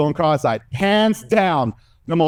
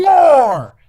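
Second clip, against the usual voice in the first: a huge high screamed "More!"; Kylo Ren scream; 10 semitones higher, 7 dB louder than usual. Retold or imitated. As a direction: belt it out like a raging villain. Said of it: "imagine Kylo Ren screaming".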